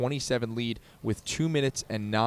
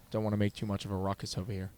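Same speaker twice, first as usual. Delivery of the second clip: soft, quick golf hush; golf announcer voice; 3 semitones lower, 9 dB quieter, faster than usual. Acted. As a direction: keep it hushed like golf. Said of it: "my golf announcer voice".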